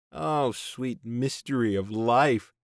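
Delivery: slow, hammy TV host; mock the show's slogan. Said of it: "their idiot slogan".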